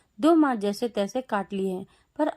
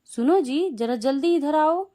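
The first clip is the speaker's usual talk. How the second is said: higher, louder call across the house; 5 semitones higher, 4 dB louder than usual. A narrator's reading. Mother-in-law calling her husband from the room, urgent loud call. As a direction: call him over urgently. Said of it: "वह चिल्लाई".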